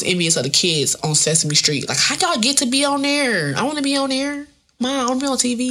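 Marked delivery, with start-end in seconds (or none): 2.0-5.6 put-on high whiny child asking mom; whiny kid voice. Child envious of TV kids.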